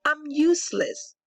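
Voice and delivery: lively picture-book read-aloud; reading to children. A narrator's reading. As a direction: read the rhyming story to the class. Reads picture book to class.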